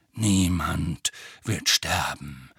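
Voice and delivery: low, slow, reassuring; steady them with a slow low calm; deep calming voice. A narrator's reading. Kingsley calms the others, deep and slow. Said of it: "mit seiner tiefen, beruhigenden Stimme".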